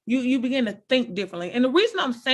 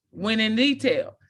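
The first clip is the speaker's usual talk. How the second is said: usual speaking voice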